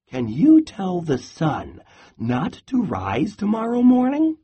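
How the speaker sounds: little squirrel. Acted. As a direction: ask it small and cheeky.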